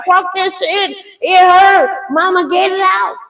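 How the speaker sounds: high-pitched pained plea; imitating daughter in labour. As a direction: act out a labouring daughter begging mom. Retold daughter in labour pain.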